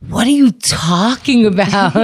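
slow, drawn-out, puzzled "What are you talking about?"; defensive tone. Retold or imitated. Friend denying his tone when pointed out, mildly defensive. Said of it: "listen to how you're saying it".

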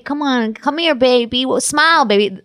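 mock catcaller. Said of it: "like, tss, tss".

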